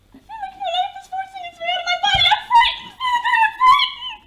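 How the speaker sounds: high, loud, fast and tearful; terrified crying. Acted. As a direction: panic as life slips away.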